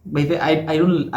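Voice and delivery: ordinary talk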